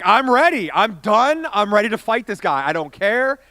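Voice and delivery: loud, worked-up defiance; acted fighting mad. Tourist ready to fight staring stranger, loud acted defiance. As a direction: burst out loud, itching to fight.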